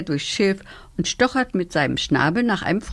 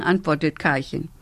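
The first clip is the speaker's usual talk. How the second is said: plain reading voice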